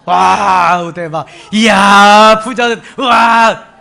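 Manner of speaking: loud bursts of wow; preacher cheering along with the congregation; playful cheer. Shown as a demonstration. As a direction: cheer freely like a child. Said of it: "한번 마음대로 한번 해보세요".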